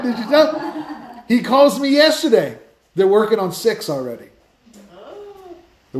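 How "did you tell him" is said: wife shouting from the background, thrilled; excited wife. Retold or imitated. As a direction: call across the room, bursting with the news, laughing. Wife calling out again.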